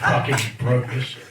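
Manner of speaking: low laughing curse; chuckles over the broken mic. Real, not acted. Comic laughing as the mic breaks.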